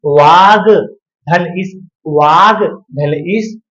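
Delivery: very slow, word by word; patient drawn-out breakdown; slow dictation. Shown as a demonstration. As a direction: lay out each piece slowly. Teacher slowly splitting a sandhi.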